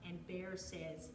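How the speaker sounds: ordinary delivery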